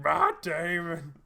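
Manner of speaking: high, slow, drawn-out yell; monster yelling. Acted. Nemesis running through the halls, drawn-out roar. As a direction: stretch the name into a roar. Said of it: "being like".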